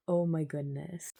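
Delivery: ordinary delivery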